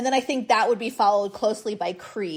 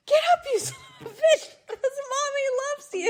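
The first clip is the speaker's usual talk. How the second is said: much higher, fast, laughing through it; laughing mock coach yell; faster than usual. Mother laughing as she quotes her Rocky line to her kids. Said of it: "But I literally am like".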